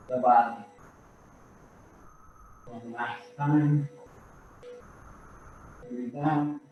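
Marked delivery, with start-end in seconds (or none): 2.7-6.6 calm counting of the breaths, gently quieter; guided breathing cues. Acted. Instructor guiding the class's breathing, soft and calm.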